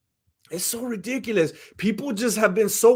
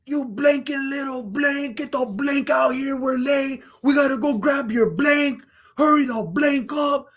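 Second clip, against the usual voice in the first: imitated scolding mom, pitched up; irate mother; 6 semitones higher than usual. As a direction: mimic a mom berating her children. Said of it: "She's like".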